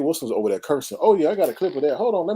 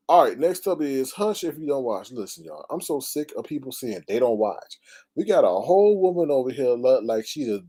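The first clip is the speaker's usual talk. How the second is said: ordinary talk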